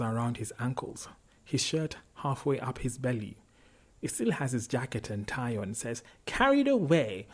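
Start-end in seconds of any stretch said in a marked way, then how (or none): 6.3-7.3 put-on high, loud English-accented exclamation; heated boardroom English. A narrator's reading. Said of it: "like a boardroom meeting that got a little heated".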